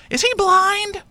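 high, far-off airhead voice, truly puzzled; clueless girl. Retold or imitated. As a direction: play her dead serious, high and innocent. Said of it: "and she's like".

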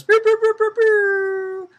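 high loud pew pew beeps; bomb timer beeps. Acted. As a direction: chirp out each pew like a timer. You're supposed to do it with me.

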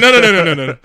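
acted emphatic brush-off; hasty dismissal. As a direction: cut them off loudly, waving away suspicion. Retold officer waving off suspicion.

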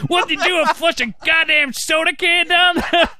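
high, laughing mock tirade; irate grandpa. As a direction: chew the kid out in a squeaky, half-laughing rage. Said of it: "He's like".

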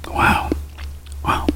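breathy whispered wow; a hushed, awed wow. Real, not acted. Reacting to food, whispered.

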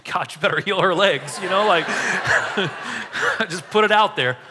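laughing through the words; real laughter, slowed down. Real, not acted. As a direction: chuckle it out, amused at himself. Laughing at his own bold promise.

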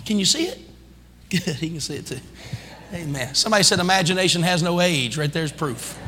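usual speaking voice

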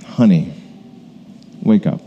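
a hint of a parent waking a daughter from a nap; tender wake-up call. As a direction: coax her awake gently. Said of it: "comes up to this little girl and says".

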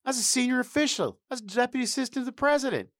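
high, fast indignant complaining; mock outrage. Mock outraged at being left off.

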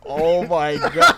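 slow, loud, drawn-out gasp of disbelief; mock dismay. Watching his new friend get held back, slow loud disbelief. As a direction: stretch it out, loud and stunned.

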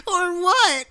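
very high-pitched squealed challenge; mocking imitation. Co-host showing how his friend sounded, mocking high imitation. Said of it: "It was like that, bro".

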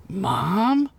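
put-on high, questioning child's voice; a small waking child asking, pitch rising; little girl. A narrator's reading. Narrator voices little girl seeing Ugla's face, questioning.

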